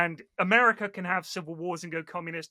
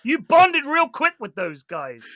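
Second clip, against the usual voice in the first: loud, high, slow teasing proclamation; mock-heroic tribute; 7 semitones higher, 11 dB louder, slower than usual. Teasing Austin about the front lines, mock-heroic shout. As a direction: proclaim it as a hammed-up war tribute.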